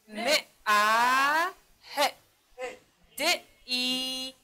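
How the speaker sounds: each sound of م، ا، ه، د، ای said separately, vowels held long; teacher stretching م، ا، ه، د، ای sound by sound; sounding out letters. Shown as a demonstration. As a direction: sound out م، ا، ه، د، ای one by one. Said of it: "در صدا کشی".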